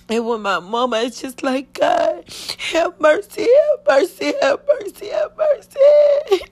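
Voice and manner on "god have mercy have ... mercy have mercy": building from high pleading to a sobbing shout, slowing at the end; real anguish rising into a wail; crying plea. Real, not acted. Reliving her cry at the hospital.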